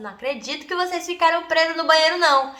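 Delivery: high, loud scolding mom; mother scolding her daughters through the bathroom door, high and loud; exasperated mom. Retold or imitated. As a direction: scold them like a fed-up mother. Mom scolding trapped kids.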